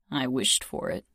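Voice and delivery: low, teasing mumble; joking mutter. Acted. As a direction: toss it off as a joke. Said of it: "he muttered jokingly".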